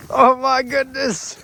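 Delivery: high, loud amazed exclamation; surprised. Real, not acted. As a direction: react in disbelief. Child stuck in snow.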